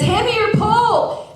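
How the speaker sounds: high, loud urgent order; urgent command. Retold or imitated. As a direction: bark it to save her from sliding. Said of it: "A T F Dave says".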